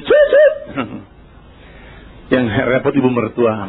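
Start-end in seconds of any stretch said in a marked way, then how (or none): 0.1-2.3 laugh-cry. Retold or imitated. Wife laughing and crying.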